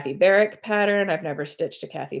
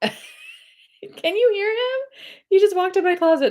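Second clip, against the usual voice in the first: laughing; 4 dB louder than usual. Real, not acted. Laughing about crying cat.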